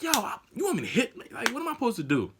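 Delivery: high-pitched incredulous questioning; exasperated protest. Retold or imitated. Driver protesting silently to the instructor, high disbelief. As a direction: plead your case in a high voice.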